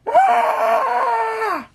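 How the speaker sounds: long, high scream; panicked scream. Acted. Team runs from the failed ambush, panicked scream. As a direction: scream high while fleeing.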